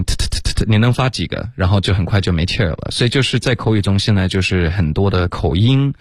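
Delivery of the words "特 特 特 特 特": "特" as a string of voiceless puffs of air; unvoiced, airy t for each "特"; repeated aspirated t. Shown as a demonstration. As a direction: fire off "特" with a burst of breath each time. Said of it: "然后发几个特的音".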